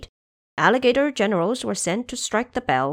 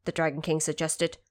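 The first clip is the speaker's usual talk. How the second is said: ordinary delivery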